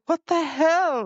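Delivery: high, baffled exclamation; high incredulous outburst; mock baffled. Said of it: "he's just like".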